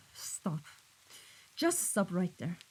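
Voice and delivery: low-volume, controlled cut-off; hushed, menacing interruption; quiet warning. Acted. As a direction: shut the excuse down softly. Boss cuts off underling's excuse.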